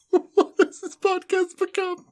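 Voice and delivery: laughing. Real, not acted. Laughing at the silliness.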